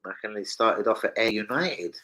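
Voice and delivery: normal talking voice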